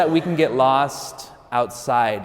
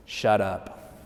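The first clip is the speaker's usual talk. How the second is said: voice of God; 4 semitones lower, 5 dB quieter than usual. Retold or imitated. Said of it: "in the clearest voice I have ever heard God speak".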